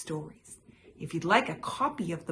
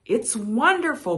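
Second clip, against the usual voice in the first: cheeped; 6 semitones higher, 8 dB louder than usual. A narrator's reading. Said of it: "Bird cheeped".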